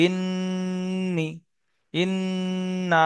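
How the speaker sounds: ইন্নি, ইন্না with the nn hummed long and level; ghunnah demonstration. Shown as a demonstration. Teacher reads drill words needing ghunnah, long nasal nn in ইন্নি, ইন্না. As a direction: stretch the doubled n of ইন্নি and ইন্না slowly. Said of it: "এখানে গুন্না করতে হবে একালি".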